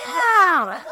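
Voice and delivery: high, put-on yeah through a laugh; mock deadpan yeah. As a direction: tease him with one knowing word. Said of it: "We're like".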